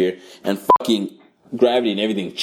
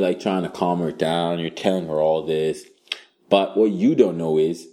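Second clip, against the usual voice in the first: ordinary talk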